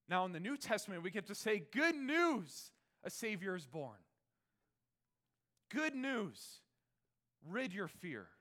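loud, high preaching; full-force preaching. Acted. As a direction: preach the good news with fire.

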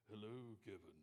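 playful imitation of a deep movie-star voice; Morgan Freeman. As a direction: drop down into a mock deep voice. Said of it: "Morgan Freeman's deep voice".

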